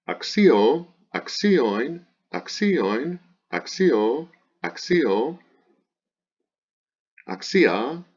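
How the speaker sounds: high singsong chant of word forms, each swinging up; paradigm recitation. Shown as a demonstration. Teacher reciting the declension, high singsong drill. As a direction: drill each form separately with an exaggerated pitch accent.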